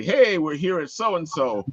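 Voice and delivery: ordinary delivery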